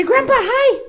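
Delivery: put-on high, loud sing-song greeting for a toddler; high-pitched coaxing hello; sing-song prompt. Shown as a demonstration. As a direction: model the greeting brightly for the child to copy.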